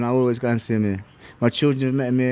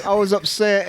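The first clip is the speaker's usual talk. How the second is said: normal talking voice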